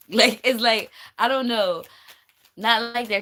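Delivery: talking through a loud laugh. Real, not acted. Reader amused by what God is sending, laughing. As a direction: laugh the words out, high and loud.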